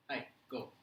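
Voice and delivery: ordinary delivery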